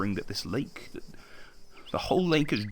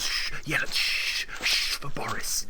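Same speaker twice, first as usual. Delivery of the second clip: mocking shush. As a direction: shush sarcastically for the great writer. Mocking shushing as Boris approaches.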